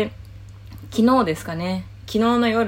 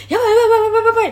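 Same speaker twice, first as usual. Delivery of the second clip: fast, high, loud rush of yabai; panicked yelling; 8 dB louder, much faster than usual. Retold or imitated. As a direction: blurt it out in a frantic rush. Panicking after being overheard.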